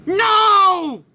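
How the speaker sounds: long, high, loud cry of "no"; desperate shout. Acted. Father cries out in story.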